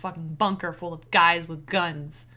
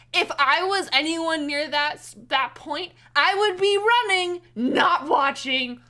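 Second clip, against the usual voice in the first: excited outburst; 7 semitones higher, 9 dB louder than usual. Real, not acted. Frightened rant about staying to watch.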